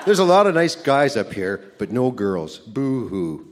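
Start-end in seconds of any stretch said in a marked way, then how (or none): 2.6-3.4 quiet, joking fake sobbing; a pretend little boo-hoo, not meant; mock sob. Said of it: "No, I'm just kidding".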